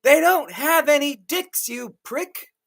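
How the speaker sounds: high-pitched indignant retort; yelling back. Acted. Sam answers Ryan while dragged off, yelling back. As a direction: yell it back high, voice straining. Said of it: "and I yelled back".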